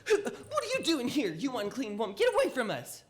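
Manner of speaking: loud, high, sneering rebuke; scornful crowd. Acted. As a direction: shove her away with your voice. Crowd sneers at unclean woman.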